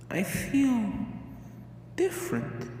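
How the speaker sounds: soft, high breathless wonder; hushed wonder. A narrator's reading. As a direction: say it in quiet awe. Pinkie after the silence.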